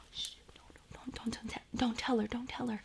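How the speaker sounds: hushed, pleading whisper; urgent whisper. Retold or imitated. Narrator begging grandma not to spoil the surprise, urgent whisper. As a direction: whisper fast so Alicia upstairs can't hear.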